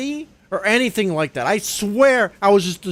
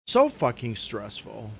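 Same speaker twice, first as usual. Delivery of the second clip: low, muttered repeat; 9 semitones lower, 6 dB quieter than usual. Real, not acted.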